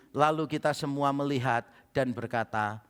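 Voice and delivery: ordinary talk, nothing marked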